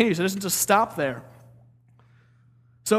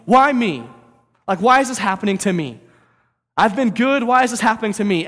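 higher, louder whiny protest; complaining Job; 4 semitones higher, 6 dB louder than usual. Retold or imitated. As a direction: plead like a wronged man. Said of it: "And he starts complaining".

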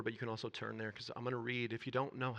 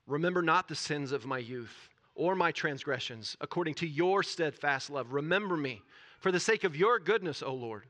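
building, louder, lifted reading; fervent scripture reading; 5 semitones higher, 9 dB louder than usual. Real, not acted. Preacher reading David's psalm to the church, fervent. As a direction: let the psalm swell into a plea.

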